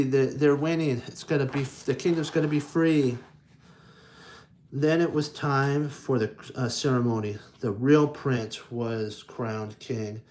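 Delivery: usual speaking voice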